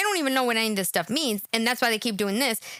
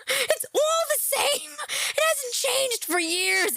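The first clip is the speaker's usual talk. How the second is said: mock whine; 11 semitones higher, much slower than usual. Mocking drawl.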